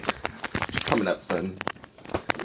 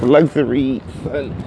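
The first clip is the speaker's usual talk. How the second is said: normal talking voice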